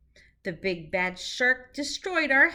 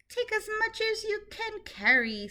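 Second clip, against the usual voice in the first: much higher, light seahorse voice; kind seahorse; 11 semitones higher than usual. Acted. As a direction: squeak it out like a tiny friendly seahorse. Seahorse speaks in the story.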